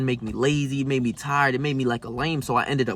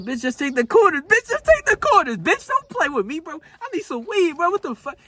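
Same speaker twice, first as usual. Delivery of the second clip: high-pitched, louder yell that climbs even higher; mock yell; 4 dB louder than usual. Teller reenacting how he yelled at the gas station cashier, high, loud mock yell. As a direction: shriek at the clerk to just take the coins.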